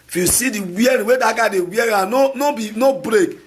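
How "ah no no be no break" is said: usual speaking voice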